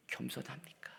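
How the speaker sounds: unvoiced, hushed question; whisper. Preacher asking the congregation about humility, whispered. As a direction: ask it like a secret.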